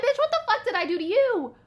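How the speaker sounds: high-pitched, acted outrage; indignant retort. Imagined comeback to an insulting friend, high indignant protest. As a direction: protest with pitch soaring, hammed up.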